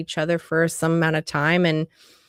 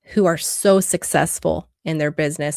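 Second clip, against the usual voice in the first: normal talking voice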